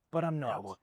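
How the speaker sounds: sudden drop after the build-up; imitating a speaker deflating the suspense; anticlimax.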